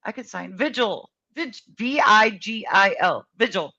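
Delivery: ordinary talk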